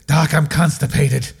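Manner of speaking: put-on strained old fellow complaining; old patient's voice. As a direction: act out the elderly patient, pleading. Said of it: "was that his voice".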